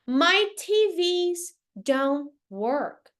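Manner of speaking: "My T Vs don't work" said very slowly; slow demo. Shown as a demonstration. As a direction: stretch out every word of "My T Vs don't work". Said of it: "As minhas T Vs não funcionam".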